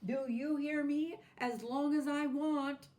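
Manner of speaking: high, loud, quick bossy orders; a spoiled toy laying down the law; bossy doll. A narrator's reading. Doll ordering Natasha to keep going, high and bossy.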